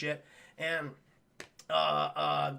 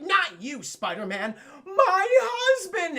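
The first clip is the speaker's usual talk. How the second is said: high, loud, ending in a long wail; hammed-up distraught wife, last words drawn out; acted exasperated wail; 10 semitones higher, 7 dB louder, much slower than usual. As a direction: melodramatic wailed correction. Wife corrects him in acted panic.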